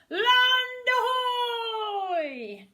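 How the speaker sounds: very slow, high, loud long call; shouting lookout. A narrator's reading. Lookout in the crow's nest calling, drawn-out shout. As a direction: call it far across the sea. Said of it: "a voice from the crow's nest shouted".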